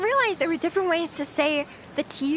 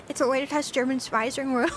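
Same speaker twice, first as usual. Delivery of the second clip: ordinary talk, nothing marked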